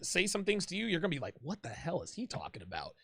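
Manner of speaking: usual speaking voice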